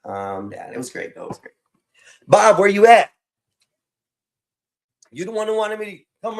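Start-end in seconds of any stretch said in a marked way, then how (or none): 2.3-3.1 loud, high call for Bob; shouting for a missing friend. Real, not acted. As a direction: call across the room. Calling for Bob.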